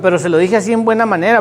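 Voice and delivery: usual speaking voice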